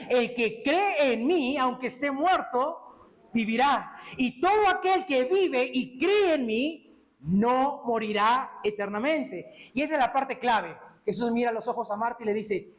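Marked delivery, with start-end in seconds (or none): 0.1-9.7 lento y enfático, alargando las palabras; proclamación solemne. Acted. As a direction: proclama una promesa de vida, sin prisa. Jesús proclama su promesa a Marta. (proclamación lenta y solemne)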